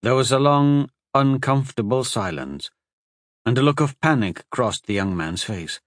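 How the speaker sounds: usual speaking voice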